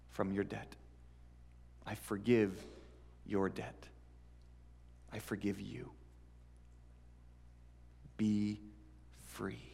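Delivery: gentle forgiveness. Acted. Boss forgives enormous debt.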